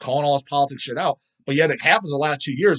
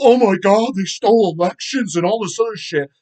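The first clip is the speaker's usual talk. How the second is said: mocking outrage; 6 semitones higher, 7 dB louder than usual. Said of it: "a lot of people pulled".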